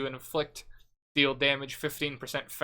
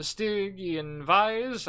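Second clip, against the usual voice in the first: weirdly drawn-out, higher, with a swooping pitch; oddly said; 6 semitones higher, much slower than usual. Real, not acted. Player listing the required belt slips into a weird pronunciation, drawn out. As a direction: stretch it out strangely and let the pitch climb. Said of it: "I said that so weirdly".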